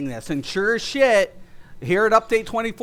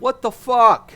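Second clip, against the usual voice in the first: exasperated; 4 semitones higher, 6 dB louder than usual. Real, not acted. Exasperated rant.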